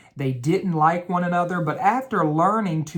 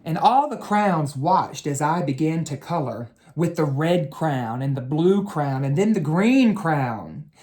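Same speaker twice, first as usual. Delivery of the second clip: story narration. A narrator's reading. Teacher reads the crown story to children, storybook narration. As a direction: read it like a bedtime story for kids. Reading a picture book to children.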